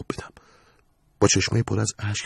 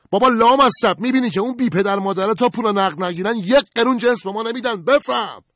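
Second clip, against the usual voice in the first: high, loud angry shout; changed, angry tone; 6 dB louder than usual. Acted. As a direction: shout it at his wife. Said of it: "لحن صدای قربان عوض شد". (فریاد خشمگین با صدای زیر)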